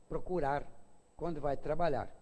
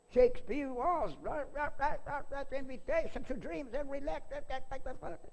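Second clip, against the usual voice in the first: much higher gibberish English, blah blah; mock English lecture; 10 semitones higher than usual. [name] lectures the audience in mock English, high and rattling. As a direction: parody an orator rattling on in English. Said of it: "continua a palestra toda em inglês".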